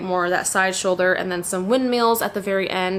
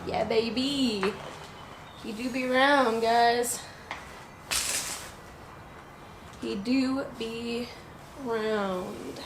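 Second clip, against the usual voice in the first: playful cooing; 4 semitones higher, 8 dB quieter, much slower than usual. Real, not acted. Showing off her round belly.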